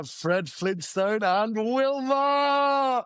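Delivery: slow, drawn-out excited answer; triumphant guess. Real, not acted. Co-host guessing the costume answer, slow drawn-out excited reveal. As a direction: stretch it out like winning a quiz.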